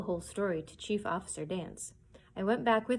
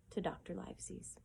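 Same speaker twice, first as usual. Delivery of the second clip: much softer, unvoiced close; whisper; 12 dB quieter than usual. A narrator's reading. Narrator ending the chapter, whispered. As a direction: hush the last words.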